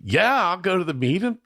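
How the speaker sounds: high, eager retold acceptance; mock-eager, pitch shooting up; eager yes. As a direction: jump at the offer like an easily enticed kid. Retelling his eager yes to cookies.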